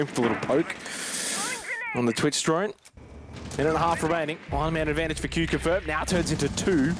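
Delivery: usual speaking voice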